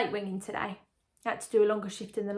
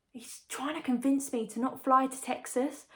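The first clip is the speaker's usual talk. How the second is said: usual speaking voice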